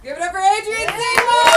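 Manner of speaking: high loud announcer call; host hype. Real, not acted. Host calls for applause for Adrian, loud announcer shout. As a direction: bring the comic off big.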